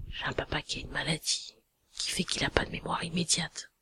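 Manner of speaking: hushed whispered confiding. Real, not acted. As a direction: whisper it like a secret about money. Parle tout bas tout du long. (chuchoté, en confidence)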